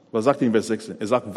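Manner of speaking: ordinary talk, nothing marked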